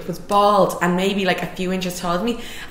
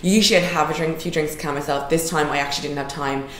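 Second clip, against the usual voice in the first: ordinary delivery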